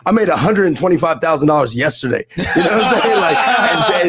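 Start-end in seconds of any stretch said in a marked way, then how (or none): 2.4-4.1 laughing. Real, not acted. Boasting about income, laughing.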